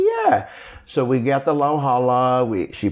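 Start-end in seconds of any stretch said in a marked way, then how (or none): 0.0-0.5 grandma's reply. Retold or imitated. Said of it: "She said".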